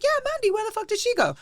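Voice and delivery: very high mock-worried voice; playing along, alarmed. Friend playing along with the Mandy excuse, high feigned alarm. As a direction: join the fake search in a high squeal.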